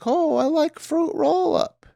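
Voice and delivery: put-on high, slow little-kid voice; a kid asking sweetly for a snack; childish high voice. Grown-up recalling how she spoke as a kid, high and slow.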